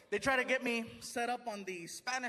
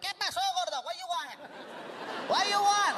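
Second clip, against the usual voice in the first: higher, pushy mock Mexican taco guy; rude taco guy; 8 semitones higher, slower than usual. Rude taco truck guy yelling at the customer, higher and pushy. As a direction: bark at the customer like a rude cook. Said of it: "the guy was like".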